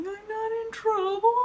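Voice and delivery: very high, whimpering question; hammed-up weepy disbelief; mock tearful. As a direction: squeak it like a crying kid seeking reassurance. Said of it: "I'm just crying and crying".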